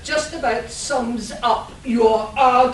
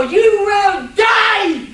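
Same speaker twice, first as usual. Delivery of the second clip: slow, higher, loud hammy menace; villain's threat; 8 semitones higher, 9 dB louder, much slower than usual. Acted. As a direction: drag it out like a cackling supervillain. Villain threatens captured hero.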